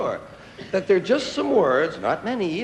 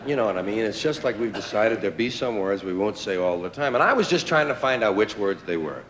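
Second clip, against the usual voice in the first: ordinary talk, nothing marked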